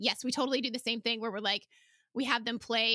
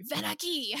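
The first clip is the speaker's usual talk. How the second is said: stern angry call, pitched up; 7 semitones higher than usual. Shown as a demonstration. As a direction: call the kids angrily. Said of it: "That was angry".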